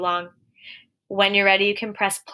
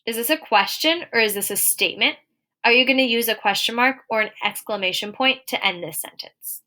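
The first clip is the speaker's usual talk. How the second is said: ordinary talk, nothing marked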